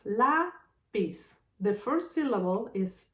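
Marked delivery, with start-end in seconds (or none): 0.0-1.3 "lapis" broken as "la... pis"; split into syllables. Shown as a demonstration. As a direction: say "lapis" one syllable at a time. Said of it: "Lapis has two syllables".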